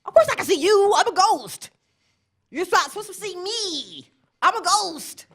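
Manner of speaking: high, loud ghost voice; indignant ghost. Retold or imitated. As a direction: scold her like an offended ghost. Ghost scolding the speaker.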